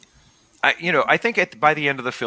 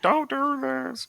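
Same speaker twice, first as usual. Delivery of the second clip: mock pleading; 5 dB quieter, slower than usual. Shrinking head begs.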